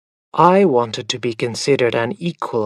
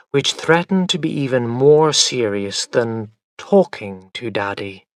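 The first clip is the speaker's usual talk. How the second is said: plain reading voice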